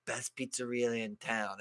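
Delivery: put-on low, soft, unhurried Italian-accented waiter; lightly imitated Italian accent, murmured. Waiter greeting diners at the door, soft Italian accent. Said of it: "in, like, this Italian accent".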